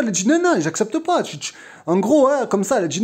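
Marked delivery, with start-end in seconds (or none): 0.3-1.2 higher, imitating a sharp refusal; retold refusal. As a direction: snap it back in mock indignation.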